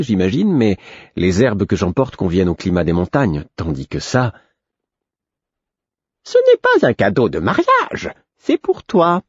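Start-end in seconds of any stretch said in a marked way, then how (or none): 6.2-9.2 very high, sing-song jester; high, insistent fool; the Fool's voice. A narrator's reading. The Fool corrects Fitz.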